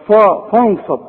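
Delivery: فانصب with a dark, backed "fa"; over-thicken فا; wrongly heavy Fa. Shown as a demonstration. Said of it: "كل دي غلط".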